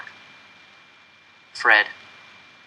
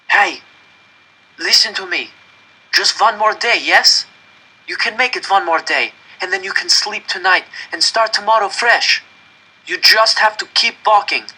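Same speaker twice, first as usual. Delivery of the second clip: raised, high, desperate urging; urgent pleading; 8 semitones higher, 4 dB louder than usual. A narrator's reading. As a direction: push him to keep walking, louder and higher. Begging boy to keep going.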